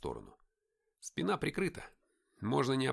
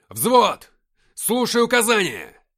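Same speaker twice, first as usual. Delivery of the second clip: high, full-force order; shouted command; 10 semitones higher, 15 dB louder, slower than usual. A narrator's reading. Captain calling the platoon to attention, shouting. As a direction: yell to the whole platoon. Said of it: "крикнул я".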